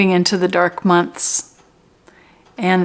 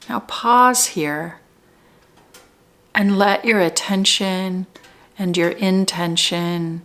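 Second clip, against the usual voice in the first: spacious, measured meditation leading; guided meditation; 3 dB quieter than usual. Real, not acted. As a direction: guide them gently into their center, unhurried. Leads guided meditation, slow with pauses.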